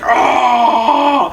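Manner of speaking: long imitated demon roar; demonic yell. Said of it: "does, like, a demonic".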